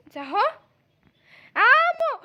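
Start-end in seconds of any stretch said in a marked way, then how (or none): 1.6-2.2 high, loud call; insistent shout. Acted. Child customer demands the pharmacist's attention, high loud shout. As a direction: yell for attention.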